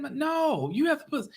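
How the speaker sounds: insist, voice up high; insistent protest. Retold or imitated. Customer cutting in on the cashier, high insistent protest.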